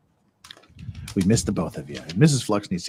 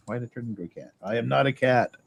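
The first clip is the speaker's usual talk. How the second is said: usual speaking voice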